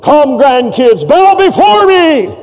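high, slow, pompous thundering voice; hammed-up grandpa playing a stern prophet; mock biblical prophet. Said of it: "I said".

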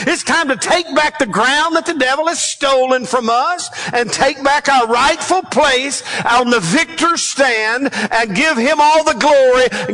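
full-force revival preaching; rising pulpit delivery. Acted. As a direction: drive the congregation forward, preacher-style. Preaching on spiritual warfare.

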